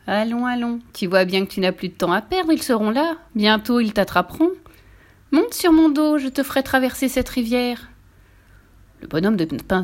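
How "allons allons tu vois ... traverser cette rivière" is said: usual speaking voice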